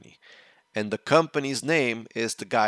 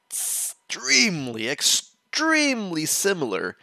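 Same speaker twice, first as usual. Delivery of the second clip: "extremely" said slow and drawn out, much higher and louder; stress on extremely; 8 semitones higher, 4 dB louder, slower than usual. Real, not acted. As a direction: lean hard into "extremely", stretching it out. Emphasizing how similar the stories are.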